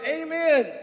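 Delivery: high, loud desperate shout of Amen. Retold or imitated. Rider at the cliff edge shouting the stop command. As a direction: yell the stop word at the last second. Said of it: "and then he shouted".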